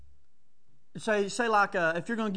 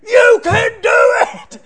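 much higher, much louder, slow shout; mock parent yelling; 13 semitones higher, 17 dB louder, slower than usual. Parent screaming encouragement at a tired kid, hammed-up yell. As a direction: holler like a breathless sideline parent.

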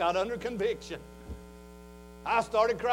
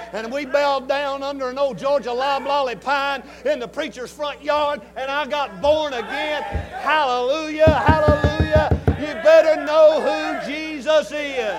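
loud, higher, slow shouting sermon; full-force preaching; 5 semitones higher, 9 dB louder than usual. Acted. Preacher shouting testimony to congregation, full-force preaching.

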